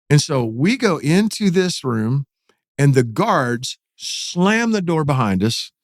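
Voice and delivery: usual speaking voice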